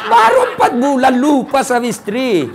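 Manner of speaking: laughs through the line; laughing. Real, not acted. Preacher laughs at the widower who remarried, laughing. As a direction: chuckle while telling the punchline.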